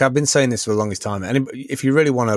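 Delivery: ordinary delivery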